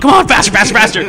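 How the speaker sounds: loud, high, frantic pleading; mock panicked urging. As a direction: hurry it along in hammed-up panic. Retelling frantic elevator escape.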